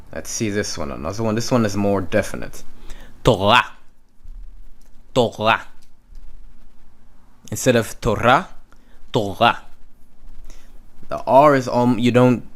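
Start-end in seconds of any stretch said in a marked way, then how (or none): 3.2-5.7 Torah with the R almost dropped; Israeli Torah. Shown as a demonstration. Teacher showing the faint Israeli R in Torah. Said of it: "you don't actually hear it". 9.1-9.6 Torah with the R almost dropped; Israeli Torah. Shown as a demonstration. Teacher showing the faint Israeli R in Torah. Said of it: "you don't actually hear it".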